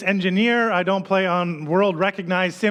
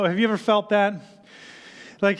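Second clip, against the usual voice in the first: normal talking voice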